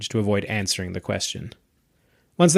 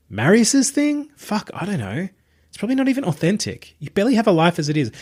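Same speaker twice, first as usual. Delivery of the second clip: put-on higher, quicker voice for a sardonic young woman; Tabitha's voice; 10 semitones higher than usual. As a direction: sardonic young woman, teasing her friend. Narrator voices Tabitha, dismissive.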